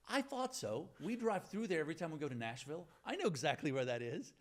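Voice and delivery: high, loud, eager classmate; excited recognition. Retold or imitated. Classmate recognizing the town name, excited. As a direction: imitate someone sure he knows the place. Classmate wrongly thinks he knows Lebanon.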